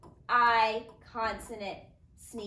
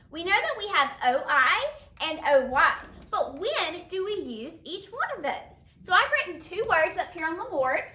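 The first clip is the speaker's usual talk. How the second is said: ordinary delivery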